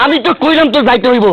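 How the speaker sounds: high, forceful order; angry insistence. Acted. Husband insists his unwilling wife must go, angry.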